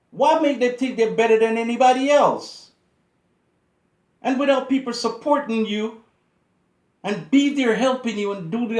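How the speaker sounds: loud, high, indignant tirade; furious outraged preaching; angry rant. Real, not acted. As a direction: tear into them at full volume. Ranting at rich athletes.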